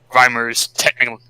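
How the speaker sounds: usual speaking voice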